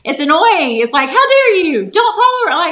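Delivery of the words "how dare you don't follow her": high, loud mock indignation; mocking big sister. Imitating big sister defending her online, high indignant mock. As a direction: huff it like a protective big sister.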